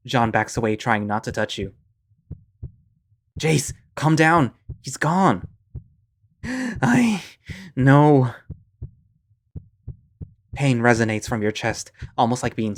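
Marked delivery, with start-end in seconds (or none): 3.4-5.5 urgent calming. Acted. Friend calming a panicking Jace. 6.4-8.3 panicked. Acted. Panicking reply.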